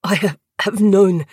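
halting, stammered conviction; pained insistence. A narrator's reading. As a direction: push the words out earnestly despite pain. Tortured prisoner insisting with stammer.

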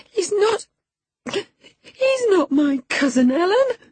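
very high, loud wailed denial; fresh grief. A narrator's reading. Girl flinging herself on her nurse, grief-stricken wail. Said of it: "gathering fresh grief from reflection".

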